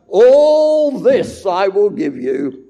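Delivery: slow, loud, drawn-out tempter's offer; Satan's promise. Retold or imitated. Preacher voicing Satan offering the kingdoms, slow and loud. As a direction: lean on All this, slow and tempting. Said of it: "For Satan promised".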